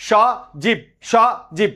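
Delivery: সজীব broken as স, then জীব; syllable by syllable. Shown as a demonstration. Teacher showing what one breath carries, সজীব in two beats. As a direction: pause between স and জীব in সজীব. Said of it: "এক নিঃশ্বাসে যতটুকু উচ্চারিত হয়".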